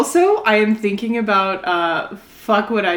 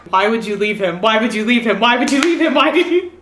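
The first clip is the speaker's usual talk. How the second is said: anguished plea building; 2 semitones higher, 5 dB louder, faster than usual. Real, not acted. Pleading with the book character.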